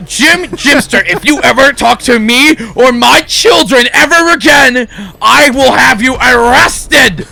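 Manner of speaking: high, loud outraged threat; furious threat. Acted. Secretary threatens the boy after hearing his answer, loud and high. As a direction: warn him off for good. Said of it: "He's like".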